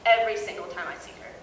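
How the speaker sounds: ordinary talk, nothing marked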